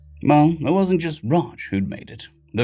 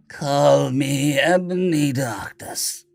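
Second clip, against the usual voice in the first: put-on bored goth teen; 3 semitones higher, much slower than usual. A narrator's reading. Bored goth teen introduces herself.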